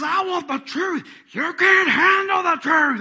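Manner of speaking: drawn-out high shouting, imitating a film showdown; movie courtroom shouting. Acting out the stand scene from A Few Good Men, shouting. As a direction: bellow it slowly like a heated courtroom outburst. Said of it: "Tom Cruise is going after him".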